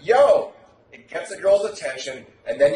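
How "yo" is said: loud call. Shown as a demonstration. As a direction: snap her attention onto you. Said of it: "You're like".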